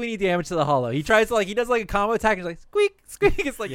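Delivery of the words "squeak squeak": high mock squeaky-toy noise; toy squeak. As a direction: squeak like a harmless stuffed toy attacking. Stuffed animal does combo attack.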